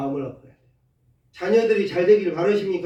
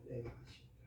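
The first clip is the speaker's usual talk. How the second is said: whispered confession; 9 semitones lower, 26 dB quieter, faster than usual. Shown as a demonstration. Preacher repeating the confession to himself, whispered. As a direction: breathe "내가 밭입니다" as a soft whisper.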